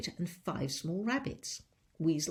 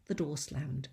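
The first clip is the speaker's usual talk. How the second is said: plain reading voice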